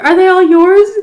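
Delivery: very high, loud mock question; dumb question. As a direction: squeal the silly question, rising at the end. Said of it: "It was so dumb".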